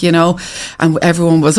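usual speaking voice

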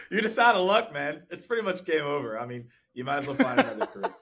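high, loud, through laughter; laughing mock verdict. Host jokes to guest about Jack's hopeless chances, laughing. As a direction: joke that it's hopeless, laughing.

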